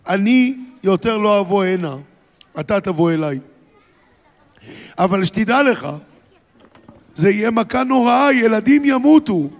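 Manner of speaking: stern warning. Acted. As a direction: warn harder and harder until it rings. Moshe warns Pharaoh of plague. (משה מזהיר את פרעה בקול מתגבר)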